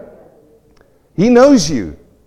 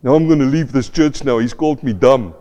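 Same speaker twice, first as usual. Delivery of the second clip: mock offended listener; 4 semitones lower than usual. Mock offended churchgoer aside.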